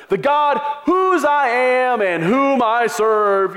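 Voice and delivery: slow, high, grand and loud; lofty biblical phrasing. Shown as a demonstration. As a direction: ham up the old-fashioned holy phrasing. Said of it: "Do you ever talk like that?".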